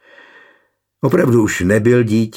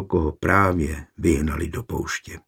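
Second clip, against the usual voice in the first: ordinary delivery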